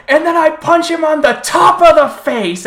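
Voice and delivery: high, loud excited yell; excited shout. Retold or imitated. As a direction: shout it like a hyped kid. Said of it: "and he goes".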